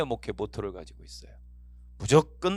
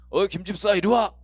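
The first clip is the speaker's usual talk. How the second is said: higher, louder casual command; imitated bossy summons; 6 semitones higher, 6 dB louder than usual. Senior pastor summoning a church deacon, imitated bossy informality. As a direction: call over a subordinate, talking down. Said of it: "막 반말을 하시더라고요".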